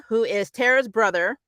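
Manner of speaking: ordinary talk, nothing marked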